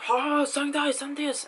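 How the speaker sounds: high, pushy mock plea; pushy autograph hunters. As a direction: imitate greedy fans in a high voice. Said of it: "autograph hunters started to, like".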